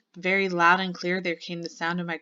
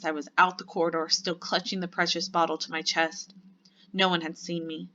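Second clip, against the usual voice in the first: ordinary delivery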